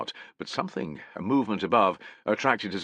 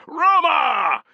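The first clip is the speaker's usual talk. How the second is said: high, loud shouted Roma; a full battle cry; 8 dB louder than usual. Acted. Legion shouting Roma, high and loud. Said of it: "shouted his men".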